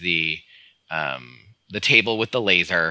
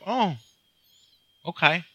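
mock surprise; 10 semitones higher than usual. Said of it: "And it's like".

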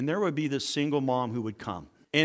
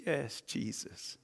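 very soft, slow, moved reply; retold tearful assent; 4 semitones lower, 12 dB quieter, slower than usual. Crying mother answers the young coach, hushed and slow. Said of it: "she started crying".

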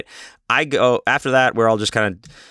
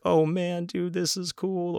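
high, soft voice of a first-timer; slow, hushed high-pitched wonder; awestruck tripper; 9 semitones higher, 7 dB quieter, slower than usual. Acted. Imitating a first-time acid tripper, high soft awe.

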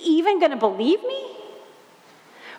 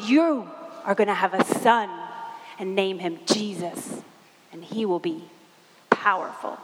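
lower, solemn angel voice, trailing to a breath; angel's voice; 6 semitones lower than usual. Acted. Mary retelling what the angel told her, lower and solemn. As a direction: speak as the heavenly messenger, low and grave. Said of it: "He said".

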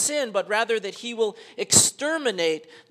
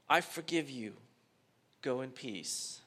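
soft, lowered pastoral pardon; calm absolution; 5 semitones lower, 6 dB quieter than usual. Acted. Pastor pardons the bank thief, calm absolution. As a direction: pronounce it gently, with pauses. Acted pastor granting forgiveness.

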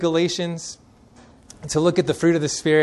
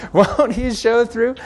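laughing; 6 semitones higher, 4 dB louder, slower than usual. Acted. Repeating the girl's line laughing.

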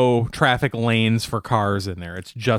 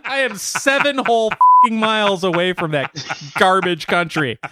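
loud, high, indignant rant; hammed-up national indignation; mock outrage; 8 semitones higher, 4 dB louder than usual. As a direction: play deeply offended and pound it out. Acts out offence at being called Welsh.

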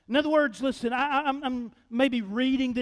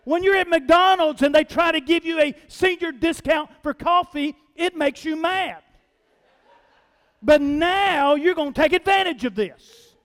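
mock outrage; 6 semitones higher, 8 dB louder than usual. Preacher's mock indignation.

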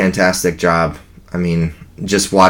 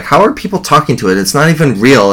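higher, faster imitation of incredulity; puzzled, higher-pitched quote; mock disbelief; 6 semitones higher than usual. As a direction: lightly act out someone baffled.

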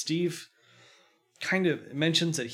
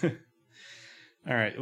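usual speaking voice

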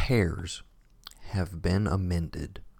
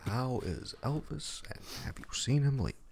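echoed question; 7 semitones higher, 7 dB quieter than usual. Retold or imitated. Repeats the interview question.

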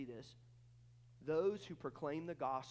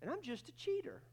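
offended husband; 7 semitones higher than usual. Acted. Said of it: "Would he go".

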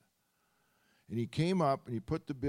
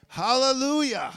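loud, high shouted hallelujah; exultant praise; 6 semitones higher, 9 dB louder than usual. Real, not acted. Preacher rousing the congregation, loud and exultant. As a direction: lift the room with praise.

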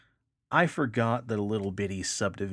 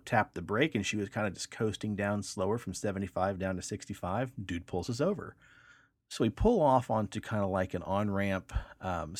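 normal talking voice

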